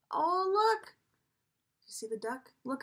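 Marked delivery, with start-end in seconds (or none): none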